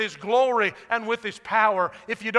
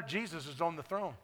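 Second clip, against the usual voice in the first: much softer, calm and settled; lowered voice; 5 semitones lower, 11 dB quieter than usual. Real, not acted. Pastor answering a doubter in the crowd, softly. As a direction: say it quietly and sure. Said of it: "so I'll lower my voice".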